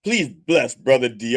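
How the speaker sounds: mock sermon prayer; preacher prayer. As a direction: intone it like a preacher. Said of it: "prayer again".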